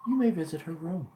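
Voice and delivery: high, loud game character voice. Acted. Game NPC answering the player, high and loud.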